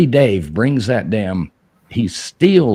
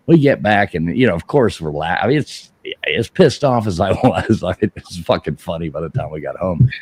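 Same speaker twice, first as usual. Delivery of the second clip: ordinary talk, nothing marked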